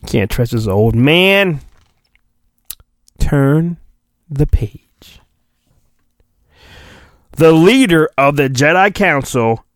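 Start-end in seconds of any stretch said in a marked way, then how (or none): 3.2-5.2 storybook cue. Pretend. Mock storybook page-turn cue.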